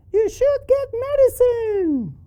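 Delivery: very high-pitched puppet voice; piping cartoon voice; squeaky puppet. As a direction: answer as a squeaky little character. Puppet Charlie answers the teacher.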